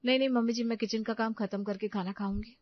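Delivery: ordinary talk, nothing marked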